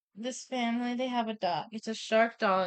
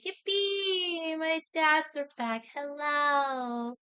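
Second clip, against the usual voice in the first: high, drawn-out gleeful cheer; playful imitation of a delighted daughter; acted excited child; 6 semitones higher, much slower than usual. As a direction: squeal a mock-childlike welcome, stretching the words. Daughter greets dads, acted out.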